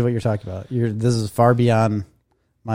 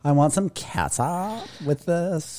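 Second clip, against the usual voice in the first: lightly put-on Valley Girl voice; 3 semitones higher, 6 dB quieter, much slower than usual. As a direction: drawl it slowly like a Valley Girl. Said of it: "kinda sounded like a Valley Girl".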